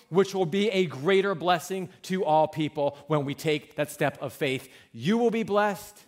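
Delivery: loud, urgent sermon delivery; full-voiced pulpit appeal; impassioned preaching. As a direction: preach it with rising conviction. Preaching builds to full force.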